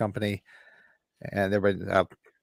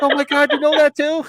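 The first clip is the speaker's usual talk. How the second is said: much higher, louder cry of amazement; amazed exclaim; 9 dB louder than usual. Retold or imitated. The cable customers marvel he knows the joke, amazed shout. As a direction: shout it like you can't believe it. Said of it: "They're like".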